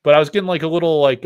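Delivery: normal talking voice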